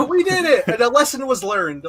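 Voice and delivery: loud, high mock celebration; excited cheering. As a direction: cheer like the heroes won. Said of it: "I was like".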